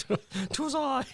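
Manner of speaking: high, slow prissy correction; primly fix the grammar in a squeaky voice; mock prim correction.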